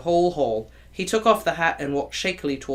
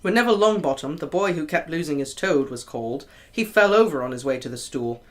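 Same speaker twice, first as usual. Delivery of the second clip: usual speaking voice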